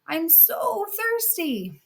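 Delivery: put-on high, slow pleading old woman; slow high thirsty old woman; old crone. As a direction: beg for water like a frail hag. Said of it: "and she hobbles over to her, and she goes".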